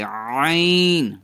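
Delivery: عین drawn out long from the middle of the throat; ain from mid-throat. Shown as a demonstration. Teacher shows students the letter, عین exaggerated from mid-throat. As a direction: stretch عین out slowly, squeezed mid-throat. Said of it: "حلق کے بالکل درمیان سے".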